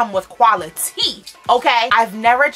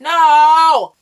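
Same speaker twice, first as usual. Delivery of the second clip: loud, much louder cry of no; frustrated shout; 8 semitones higher, 10 dB louder than usual. Real, not acted. Vlogger upset by the broken mirror, loud no. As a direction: yell in frustration.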